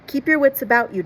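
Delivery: urgent warning. A narrator's reading. Captain's urgent warning to Jim.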